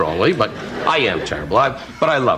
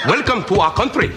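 higher, hammed-up Indian accent greeting; mock Indian accent; 9 semitones higher than usual. Comic welcome to England in a put-on Indian accent. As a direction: play a mock Indian official welcoming guests. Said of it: "they talk like that 'cause they're all from India".

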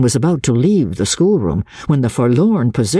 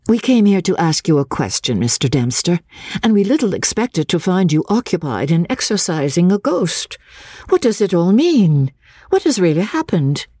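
higher-pitched lady's voice, pressing for answers; a higher, questioning lady; Miss Halcombe, brisk questioning; 7 semitones higher than usual. A narrator's reading. Miss Halcombe asks the schoolmaster what happened, brisk questioning.

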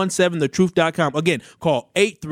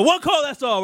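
high, sing-song slogan hook; pitched-up catchphrase for the giveaway; slogan call-out; 10 semitones higher than usual. Acted. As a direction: sell the slogan like a radio jingle.

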